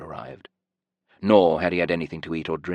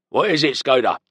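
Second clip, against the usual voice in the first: loud sharp demand, pitched up; guard demanding; 8 semitones higher, 4 dB louder than usual. A narrator's reading. Guard woken at night demands answers, loud. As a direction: bark the question at the prisoner. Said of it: "the guard demanded".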